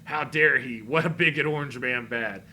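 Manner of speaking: loud mocking imitation of liberal outrage; loud sarcastic mimicry of liberal media. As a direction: ham up the indignant critics. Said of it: "Democrats and liberal media".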